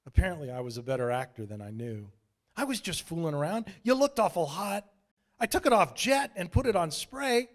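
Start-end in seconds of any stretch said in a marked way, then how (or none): 2.5-7.5 apologetic Shane. A narrator's reading.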